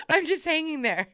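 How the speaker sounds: real laughter, pitched high; laughing. Real, not acted. Recounting her failed gymnastics attempt, laughing. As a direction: laugh through the words, voice lifted high. Laughing at own childhood video story.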